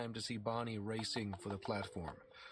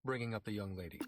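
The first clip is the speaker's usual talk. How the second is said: usual speaking voice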